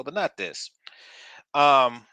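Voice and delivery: ordinary delivery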